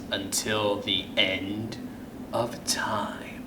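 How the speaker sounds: fading to a whisper; spooky whisper. A narrator's reading. Storyteller closing the spooky tale, fading to a whisper. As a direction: let it die away eerily.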